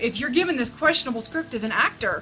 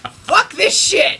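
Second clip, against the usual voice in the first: slow, louder mock curse; mock Lucas outburst; 9 semitones higher, 10 dB louder, slower than usual. Reviewer voicing Lucas cursing, slow loud mock outburst. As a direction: spit it out in fake disgust. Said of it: "You said".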